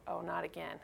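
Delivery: soft, deflated thought to herself; half under her breath, like a sigh; dismayed mutter. Retold or imitated. Inner thought on cash only.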